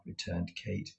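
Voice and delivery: ordinary delivery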